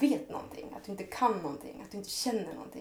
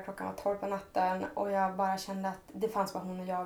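ordinary talk, nothing marked

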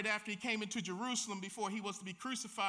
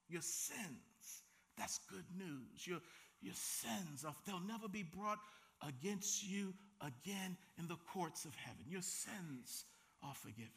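hushed awe; 5 semitones lower, 11 dB quieter than usual. Real, not acted. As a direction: let each word sink in quietly. Preacher savours the pardon.

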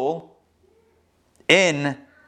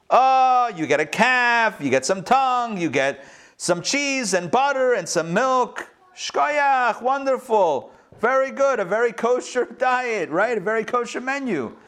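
higher, like a cheery innkeeper selling the menu; mock host enthusiasm; 6 semitones higher than usual. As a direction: pitch the breakfast menu with mock delight. Bed and breakfast menu pitch.

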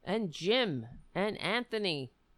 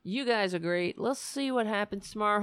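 ordinary talk

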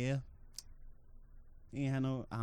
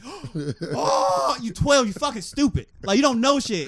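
higher, louder scornful yell; hammed-up angry parent; mock scolding; 9 semitones higher, 7 dB louder, slower than usual. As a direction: scoff at a twelve-year-old.

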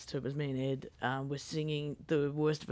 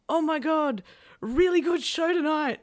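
high, louder gushing fan voice; 11 semitones higher, 7 dB louder than usual. Retold or imitated. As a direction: play the starstruck fan, pitched up high. Fans stop the band's car.

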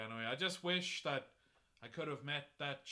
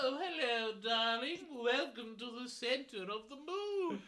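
higher, posh royal voice; hello darling as the Queen; 7 semitones higher than usual. Acted. Voicing Queen Elizabeth.

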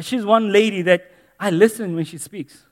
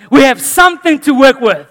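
emphatic repeat; 7 semitones higher, 11 dB louder than usual. Shown as a demonstration. Repeated, second louder.

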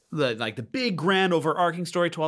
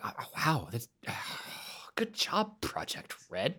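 awed whisper; 9 dB quieter than usual. Retold or imitated. Moved by the character's softness.

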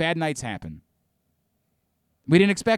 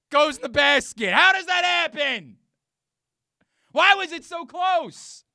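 high, loud disbelieving yell; incredulous shouting; 14 semitones higher, 6 dB louder than usual. Real, not acted. Host shouting in disbelief at a three-quarter-court buzzer beater. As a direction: cry out in disbelief at the lucky shot. Incredulous rant about basketball shot.

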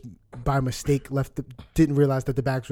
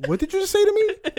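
much higher, sharp challenge; confronting the man; 4 dB louder than usual. Retold or imitated. Challenging the old man who insulted him, high and sharp. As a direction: fire the question back high and hard. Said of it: "I was like".

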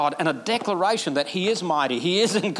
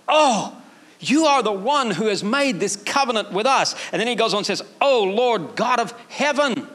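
preaching at full force; 6 semitones higher than usual. Acted. Preacher voicing Nehemiah's prayer to the church, full force. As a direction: preach it out with fire.

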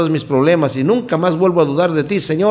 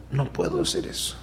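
much higher, hushed and soft; soft refusal; 13 semitones higher, 12 dB quieter than usual. Acted. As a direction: refuse gently, almost under the breath. David refusing his friends.

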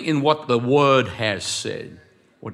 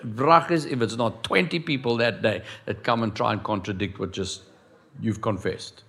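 ordinary delivery

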